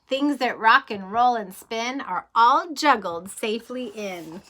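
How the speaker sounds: lively rhythmic storybook read; reading to children. Acted. Teacher reading a train book to kids, lively storybook voice. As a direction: read the rhyme like a bedtime adventure.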